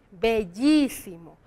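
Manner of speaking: "bellísimo" slowly, long "llí", falling close; stress on í in bellísimo. Shown as a demonstration. Teacher confirming the accent, "bellísimo" drawn out on the í. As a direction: stretch the í of "bellísimo", then let it drop. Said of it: "tiene acento en la".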